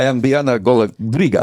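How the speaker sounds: hammed-up Spanish accent. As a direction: introduce yourself in a mock Spanish accent. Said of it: "Why am I doing a Spanish accent?".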